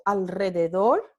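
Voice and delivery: alrededor with a rolled r after the l; trilled r. Shown as a demonstration. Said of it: "va a ser una vibrante múltiple".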